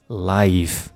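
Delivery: normal talking voice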